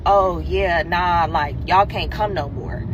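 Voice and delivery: low, cool brush-off; imitating unbothered guy. Boy cancels the boat invite, imitated low and offhand. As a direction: drop low to mimic a guy backing out.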